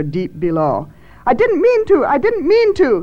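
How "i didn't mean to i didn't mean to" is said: high, loud desperate cry; panicked shout. A narrator's reading. Thad shouts as a wail rises from the well, panicked shout. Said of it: "he shouted as another wail rose".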